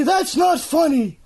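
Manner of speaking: high, slow indignant squeak; squeaky outraged voice. Acted. An offended character squeals at the joke, high and slow. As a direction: squeal it out like an offended little critter.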